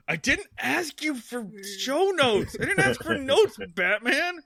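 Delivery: high-pitched playacted protest; mock exasperation. Acting writer annoyed at Batman.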